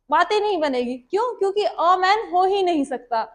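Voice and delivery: ordinary delivery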